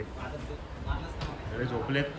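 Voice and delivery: soft slow hush so nobody wakes; hushed, people sleeping. Real, not acted. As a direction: drop to a near whisper. Everyone asleep, hushed voice.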